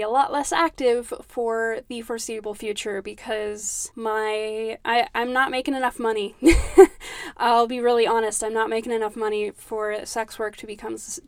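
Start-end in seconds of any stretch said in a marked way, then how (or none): 6.4-7.3 loud, high embarrassed laugh; rueful laugh. Real, not acted. Host laughs awkwardly about her money troubles.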